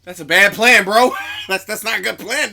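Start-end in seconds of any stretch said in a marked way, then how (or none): 0.0-1.1 louder jeering call-out; loud mocking. Real, not acted. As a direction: heckle the bad plan.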